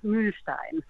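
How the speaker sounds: "Mühlstein" said the German way, "shtein" ending; "Mühlstein" with German "sht" in the middle; German surname pronunciation. Shown as a demonstration. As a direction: show how to say "Mühlstein" in German, with a long "ü". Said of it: "so I pronounce it".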